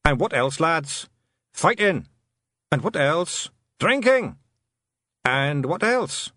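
rousing Scottish clansmen, high answers; little clansmen rallying each other; Feegle call and answer. Acted. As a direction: prompt the lads, then cry the answer high. Leader and men call and answer.

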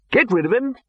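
high, commanding bark; blunt order. Acted. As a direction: order it done. Arch-Chancellor orders.

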